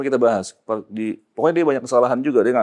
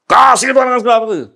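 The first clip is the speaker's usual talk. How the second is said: higher, louder mock shout of the command; 11 semitones higher, 8 dB louder than usual. Preacher mocking churchgoers who yell their love, mock shout. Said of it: "kenapa kita teriak kenceng-kenceng".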